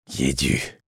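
voix pâteuse, basse et plus douce. Acted. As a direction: articuler lourdement, bouche pâteuse. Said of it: "d'une voix pâteuse". (voix pâteuse d'un homme à demi réveillé)

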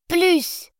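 put-on high wailing toddler cry; child's cry. A narrator's reading. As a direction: cry out like a frustrated little girl. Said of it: "s'écria-t-elle".

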